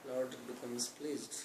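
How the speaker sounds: normal talking voice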